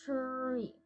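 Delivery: TREE drawn out slowly on a held r; soft, low, stretched-out TREE; slow stretched word. Shown as a demonstration. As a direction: say TREE slowly, gliding from sound to sound. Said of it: "Nice and slow".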